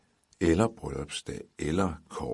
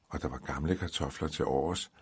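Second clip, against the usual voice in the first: ordinary delivery, nothing marked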